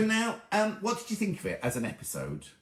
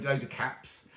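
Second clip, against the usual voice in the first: normal talking voice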